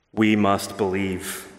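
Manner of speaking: ordinary talk, nothing marked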